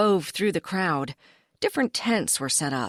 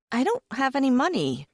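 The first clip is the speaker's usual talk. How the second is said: protesting; 7 semitones higher, faster than usual. Acted. Character protests.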